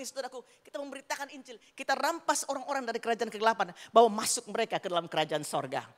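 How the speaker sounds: ordinary talk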